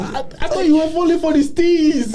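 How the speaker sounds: real laughter, very high-pitched; helplessly amused ribbing; teasing through laughter. Real, not acted. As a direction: squeal the tease while cracking up. Teasing friend while laughing hard.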